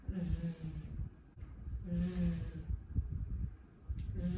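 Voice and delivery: quiet buzzing z repeated; z sound. Shown as a demonstration. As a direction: buzz z z z softly. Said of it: "can you make that sound".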